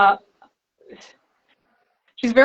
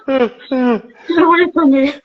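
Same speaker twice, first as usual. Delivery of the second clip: slow, laughing mock panic; panicky laughing yell; 4 semitones higher, 6 dB louder, much slower than usual. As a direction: flail and laugh as you shout it. Acting out panicky fighting.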